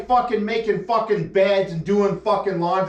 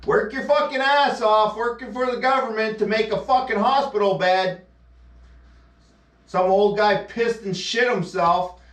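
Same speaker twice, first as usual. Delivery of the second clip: normal talking voice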